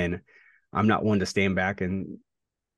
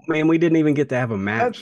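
excited; 8 semitones higher, 5 dB louder, faster than usual. Real, not acted. Excited outburst.

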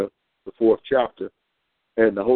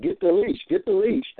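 high, excited puppy voice, rising; pleading dog begging for a walk; eager dog voice; 8 semitones higher than usual. Retold or imitated. Dog nudging owner's hand, high and eager for the leash. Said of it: "hit you by the hand like".

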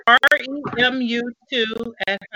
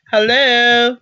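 calling out; 4 semitones higher, 6 dB louder than usual. Real, not acted. Calling into a dead call.

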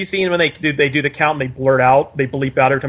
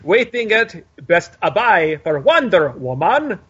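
slow, higher hammy vampire drawl; mock Dracula; 5 semitones higher, slower than usual. Giving his plug still in a mock Dracula voice. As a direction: linger on each phrase like Dracula.

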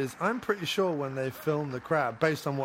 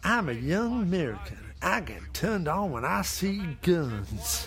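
higher, swooping caricature of an American; mock young gun fanatic; 5 semitones higher than usual. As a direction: put on an eager gun-loving American. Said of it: "He's just like".

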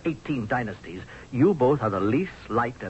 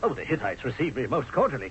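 usual speaking voice

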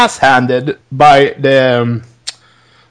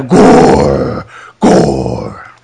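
very low, drawn-out growl; growled catchphrase. Acted. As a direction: growl the word out long and deep. Announcer-style growled move name.

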